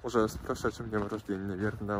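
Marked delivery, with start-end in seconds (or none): none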